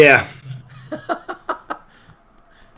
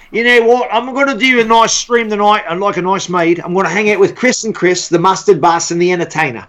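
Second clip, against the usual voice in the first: put-on lightly done Australian accent, a laugh creeping in; a hint of an Australian accent; mock Aussie stream intro. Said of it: "my Australian accent".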